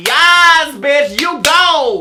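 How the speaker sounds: loud drawn-out cheer; new generation cheering the boy on, loud and slow. Retold or imitated. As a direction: cheer him on like a hype fan. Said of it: "They were like".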